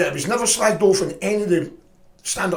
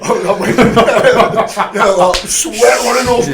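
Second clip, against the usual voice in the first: loud, high laughing whoop; 6 semitones higher, 10 dB louder, much slower than usual. Real, not acted. Retelling the fight to his mate, laughing loudly. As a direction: crack up loudly at the memory.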